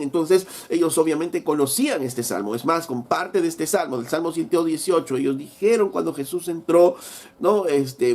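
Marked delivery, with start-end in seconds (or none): none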